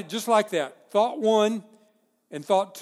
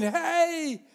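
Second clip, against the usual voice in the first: higher mock shout for a passing ship; calling for help; 8 semitones higher than usual. Stranded person hailing a ship, mock call. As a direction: wave down a ship. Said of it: "on the shore saying".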